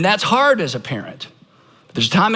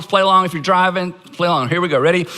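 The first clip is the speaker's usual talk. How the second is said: normal talking voice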